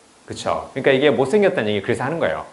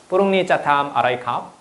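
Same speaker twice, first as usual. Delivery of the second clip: imitated Thai; 3 semitones higher than usual. Shown as a demonstration. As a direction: mimic a Thai speaker with odd sing-song tones. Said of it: "되게 이게 태국 사람 같냐?".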